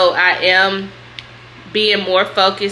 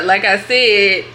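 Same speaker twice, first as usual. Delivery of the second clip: usual speaking voice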